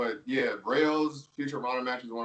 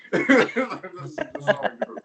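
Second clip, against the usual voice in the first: laughing; 10 semitones higher, 5 dB louder, much slower than usual. Real, not acted. Laughing at the silly inside joke.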